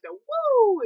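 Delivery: a high, loud crowd "woo!"; sudden hockey-crowd whoop; Boston woo. Shown as a demonstration. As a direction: belt the "woo!" like a whole arena. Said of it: "the thing that they do in Boston".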